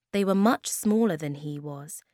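ordinary delivery

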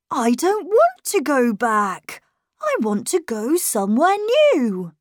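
lightly voiced boy, calm and bright; calm and cheerful. A narrator's reading. Boy tells governess he wants somewhere new, cheerful. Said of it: "He spoke calmly and cheerfully".

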